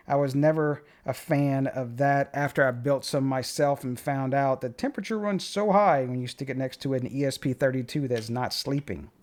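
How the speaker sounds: ordinary talk, nothing marked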